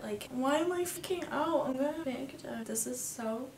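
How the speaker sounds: quietly shaken as panic rises; real shaken nerves. Real, not acted. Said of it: "I'm getting so hot talking about it".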